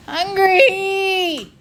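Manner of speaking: high, loud shriek. A narrator's reading. The child shrieks at the fleeing boys, ghostly shriek. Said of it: "you shrieked".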